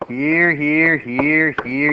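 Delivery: normal talking voice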